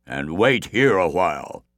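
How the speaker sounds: lightly voiced giant order; calm command; relaxed giant.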